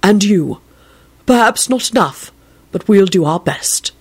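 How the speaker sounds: measured, mildly steely resolve; understated determination. A narrator's reading. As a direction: commit firmly to a grim duty. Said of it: "in a steely voice".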